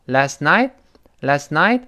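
LAST NIGHT slow, NIGHT swept upward; rising NIGHT. Shown as a demonstration. Teacher models question tune, NIGHT rising at the end. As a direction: lift the pitch right up on NIGHT. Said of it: "night晚上一定是升调".

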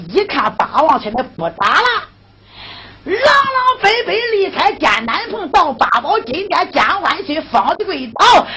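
ordinary talk, nothing marked

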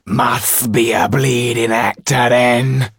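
loud, slow sneering thug. Acted. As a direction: sneer at the penniless actor.

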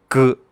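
the K of 'skirt' turned into a hard 'g' sound; K voiced as g. Shown as a demonstration. Teacher explaining a sound change to the class, K said as hard 'g'. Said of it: "那个K要读成".

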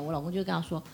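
ordinary talk, nothing marked

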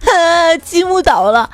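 fake wailing. Dad fakes crying before his son can, in a hammed-up loud wail. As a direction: pretend to bawl like a toddler over the blocks. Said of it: "嗷嗷大哭".

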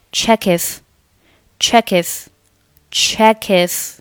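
slow "check if" joined as "che-kif"; linked check if. Shown as a demonstration. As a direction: carry the k of "check" into "if", slowly. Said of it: "check和if连读成".

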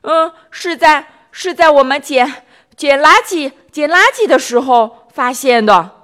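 high halting stammer, repeating words. Acted. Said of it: "更结结巴巴地说". (更加结结巴巴地说)

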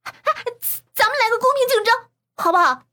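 high, loud, quick call; urgent high-pitched proposal; crying out. A narrator's reading. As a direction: shout it to break up the fight. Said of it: "栾婷婷叫道".

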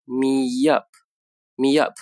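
"me up" joined with a light y glide, very slow; slow demo of "me up" linked. Shown as a demonstration. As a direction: glide from "me" into "up" slowly. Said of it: "所以读成".

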